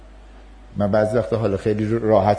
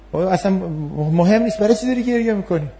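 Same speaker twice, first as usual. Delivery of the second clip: dismissive parent; 7 semitones higher than usual. Acted. Parent dismissing child's tears.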